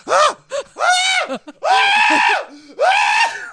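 high, loud mock screams of a teen in labor; imitating a girl screaming through a curtain. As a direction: belt out repeated pained screams, hammed up.

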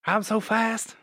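lightly voiced southern boxer; a quick go at a breathless boxer's boast; Muhammad Ali. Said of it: "why you're not doing the voice".

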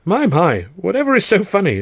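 high, genteel curiosity; amused older lady. Acted. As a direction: play a kindly mother peering out. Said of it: "a voice said".

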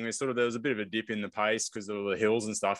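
ordinary talk

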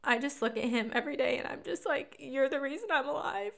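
crying through the words; tearful. Real, not acted. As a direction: voice breaking with tears. Tearful, voice high.

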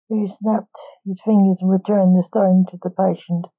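plain reading voice

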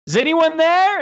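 high, loud call into an empty house; mock shouted call. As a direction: yell out to see if anyone's home. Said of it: "Stop fucking yelling".

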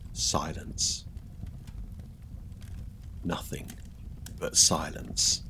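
breathy hush, almost whispered. A narrator's reading. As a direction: let the silence hang. Dramatic hush in story.

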